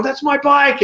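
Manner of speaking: high, retold protest; indignant call. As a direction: claim it from a distance. Retold claim to stranger, calling.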